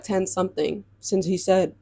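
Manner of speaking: usual speaking voice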